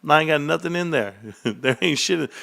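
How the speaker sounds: ordinary talk, nothing marked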